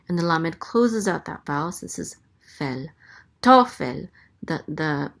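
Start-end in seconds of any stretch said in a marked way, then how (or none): 3.4-4.1 "Tofel" with TO high and loud; stress on first syllable. Shown as a demonstration. Teacher sounding out a Hebrew name, stress on TO of "Tofel". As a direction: punch the TO of "Tofel", drop on fel. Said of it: "the accent is on the first syllable".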